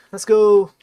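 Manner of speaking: ordinary delivery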